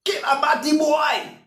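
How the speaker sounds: a high, hostile street shout; retold hostile shout. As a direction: hurl it like a man in the crowd. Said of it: "The men who would be shouting out".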